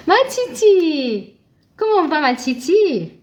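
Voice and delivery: drawn-out, high happy call to a viewer; delighted greeting. Real, not acted. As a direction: sing out her name, delighted. Greets a viewer joining live. (accueil ravi et chantant d'une élève)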